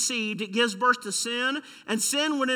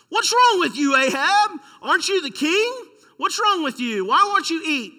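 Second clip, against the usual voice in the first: higher and louder, a hint of a demanding queen; scolding wife; 7 semitones higher, 5 dB louder than usual. Pastor voices Jezebel scolding her sulking husband, higher and louder. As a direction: needle him like an impatient queen.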